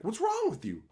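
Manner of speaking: high, incredulous mock question; mock disbelief. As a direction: imitate baffled headshakers. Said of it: "shaking their heads like".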